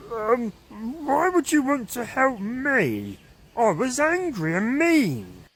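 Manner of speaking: stammer, unsure of the kindness; stuttering. Acted. Caveman stuttering to the kind neighbour, lightly hesitant. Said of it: "and he stuttered".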